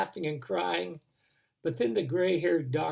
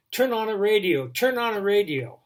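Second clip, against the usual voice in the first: loud, higher urgent plea to turn on the radio; urgent call; 6 semitones higher, 7 dB louder than usual. Retold or imitated. Man running in from parking lot, urgent loud call. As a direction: call it out in alarm.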